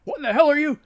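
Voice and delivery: loud, high bewildered yell; an angry doctor startled mid-rant; loud furious cry; shouting doctor. Acted. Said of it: "breaks off his shouting".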